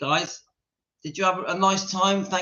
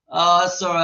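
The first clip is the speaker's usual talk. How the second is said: ordinary talk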